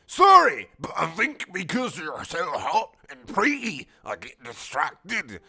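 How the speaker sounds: high squeak at sorry, then slow; hammed-up Wheatley. Robot flirting with Marissa, high slow hammed-up voice. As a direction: play a flustered goofy robot.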